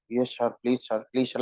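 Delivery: soft, low, timid pleading; quiet nervous pleading to the teacher. Shown as a demonstration. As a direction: shrink and beg meekly like a scared pupil. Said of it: "Sometimes you use a nervous tone".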